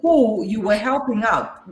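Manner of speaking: ordinary talk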